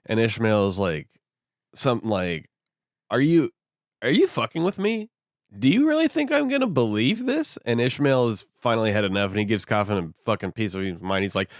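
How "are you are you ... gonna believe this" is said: high-pitched mock disbelief; incredulous. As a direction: act out indignant incredulity, pitch climbing. Acting Ishmael's disbelief at innkeeper.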